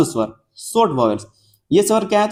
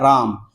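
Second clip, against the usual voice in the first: राम with the AA pulled long; stretched vowel; 6 semitones lower, much slower than usual. Shown as a demonstration. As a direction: hold the आ in राम. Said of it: "उसको खींचना पड़ रहा है".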